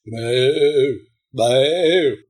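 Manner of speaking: ordinary delivery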